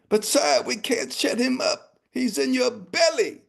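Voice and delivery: high, pleading guard voice; worried guard. Acted. Guard protesting to the king, high and pleading.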